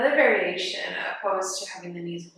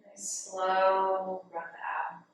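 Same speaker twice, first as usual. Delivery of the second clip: guided meditation. Real, not acted. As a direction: guide them gently into the breath, softening on the counts. Leads breathing meditation.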